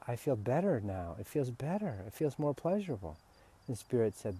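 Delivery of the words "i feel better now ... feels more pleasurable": softer, hushed admission. Retold or imitated. Answering Spirit, soft and hushed. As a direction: quietly admit it feels better. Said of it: "I actually had to say".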